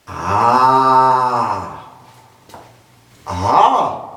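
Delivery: affirming ah. Shown as a demonstration. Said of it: "Aber auch eine Bestätigung".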